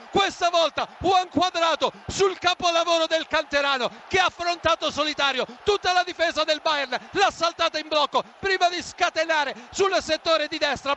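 high, excited replay narration; excited goal recap. Real, not acted. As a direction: still thrilled, describing the goal. (racconto entusiasta del gol)

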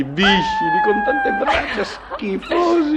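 very high, slow revolted cry; squealing in horror; disgusted shriek. Acted. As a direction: stretch out the disgust. Husband describes disgusting creatures.

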